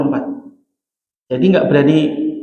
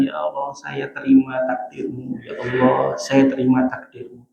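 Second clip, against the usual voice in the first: quiet resigned prayer; 4 semitones lower, 6 dB quieter than usual. Retold or imitated. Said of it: "cuma nitikin air mata".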